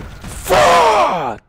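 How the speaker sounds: very high, loud, drawn-out scream; real frustrated shriek; screamed curse. Real, not acted. Fails at hard level.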